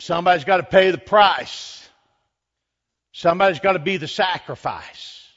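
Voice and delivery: forceful declaration. Acted. Preacher voices God demanding a sacrifice, forceful declaration. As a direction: declare it with weight, voice lifted.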